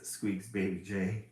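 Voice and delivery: ordinary delivery, nothing marked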